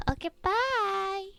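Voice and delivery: high cutesy bye. Real, not acted. Goodbye to viewers.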